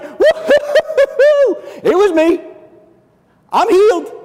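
healed woman shouting for joy; joyful whoop. Acted. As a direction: whoop like she can't contain it.

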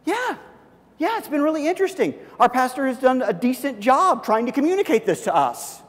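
high, upbeat enthusiastic reply; warmly eager to share; eager answer. Pretend. Believer answering a curious coworker, high eager enthusiasm.

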